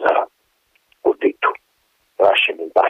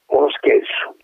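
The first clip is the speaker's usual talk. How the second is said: stunned whisper; 7 semitones higher than usual. Acted. Colonel repeats in disbelief.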